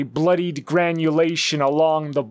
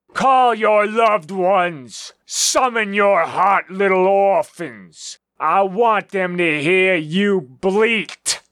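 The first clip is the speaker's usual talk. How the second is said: slow, booming villain taunt; gloating villain shout; 3 semitones higher, 3 dB louder than usual. Acted. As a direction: bellow it out like a gleeful tyrant. Villain gloats over bleeding victims.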